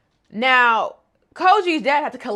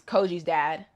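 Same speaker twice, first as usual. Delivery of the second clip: ordinary talk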